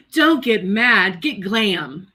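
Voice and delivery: ordinary talk, nothing marked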